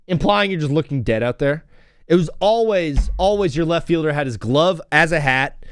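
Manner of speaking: ordinary talk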